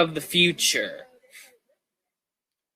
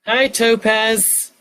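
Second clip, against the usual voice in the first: cheery greeting; 7 semitones higher, 3 dB louder, much slower than usual. Real, not acted. Greeting a viewer who joined.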